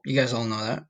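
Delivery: ordinary talk, nothing marked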